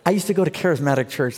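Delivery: ordinary delivery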